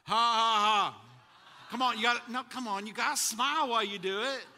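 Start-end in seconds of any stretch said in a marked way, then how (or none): 0.0-1.0 loud, deliberate ha ha ha; a big put-on laugh to show them how; laugh on purpose. Shown as a demonstration. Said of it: "you really need to practice".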